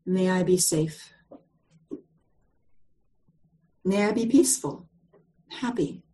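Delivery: meditation phrases. Shown as a demonstration. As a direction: lead them gently through each wish. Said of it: "the words go like this".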